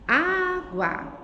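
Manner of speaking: "Água" com o Á longo, forte e bem mais agudo, depois gua; sílaba tônica destacada. Shown as a demonstration. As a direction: carregue no primeiro A de "Água", separando as sílabas. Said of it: "Qual sílaba que eu falo com mais força?". ("Água" silabada, com força no Á)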